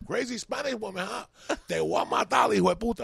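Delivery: high, furious threat in Spanish; angry mother. Retold or imitated. Mother threatening her son, furious and high. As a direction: go after him, raging.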